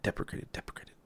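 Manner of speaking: whispered under the breath, trailing off. Real, not acted. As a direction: mutter the word deprecated twice in a whisper. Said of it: "Don't know why I'm whispering".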